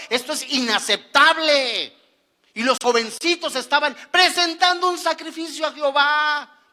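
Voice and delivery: loud, driving sermon; fiery preaching. Acted. Preacher condemning sin before the congregation, loud and driving. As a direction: build the outrage phrase by phrase.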